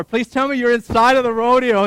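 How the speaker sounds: high, loud plea; pleading. Retold or imitated. Husband hoping wife is safe inside, pleading.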